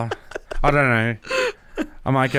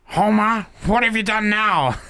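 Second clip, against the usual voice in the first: high-pitched cartoon scolding of Homer; Simpsons impersonation; 8 semitones higher than usual. Co-host asked for the Simpsons bit, high cartoon impersonation. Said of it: "do the Simpsons impersonation".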